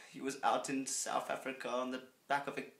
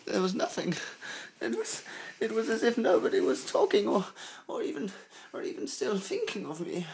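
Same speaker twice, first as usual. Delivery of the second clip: much higher, louder anguished wail; hammed-up despair, pitched way up; melodramatic outburst; 8 semitones higher, 8 dB louder than usual. Reader hamming up the heroine's despair, wailing loud and high. Dramatic reading breaks into outburst.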